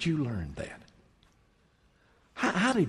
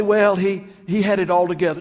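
mock objector; 4 semitones higher, 6 dB louder than usual. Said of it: "You say".

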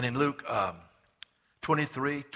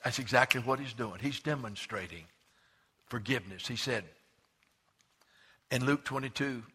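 ordinary delivery

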